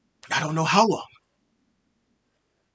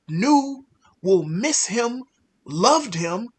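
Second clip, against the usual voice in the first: usual speaking voice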